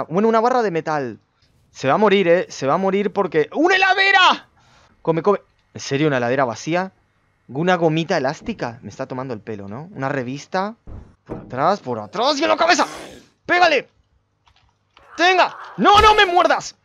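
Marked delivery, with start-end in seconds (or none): 3.5-4.5 sudden high, loud exclamation; excited discovery. Real, not acted. Gamer spotting a fridge mid-sentence, sudden excited shout. Sudden find while doomed. 11.2-16.8 high, loud frantic commands; panicked combat shouting. Real, not acted. Player fighting off a biting zombie, panicked yelling.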